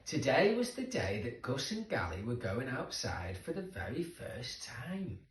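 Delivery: usual speaking voice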